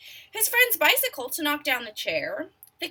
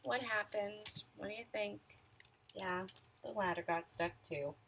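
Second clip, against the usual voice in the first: put-on much softer, coaxing the children; soft question; 4 semitones lower, 15 dB quieter than usual. A narrator's reading. Reader quietly asks the children to guess. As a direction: draw the kids in quietly.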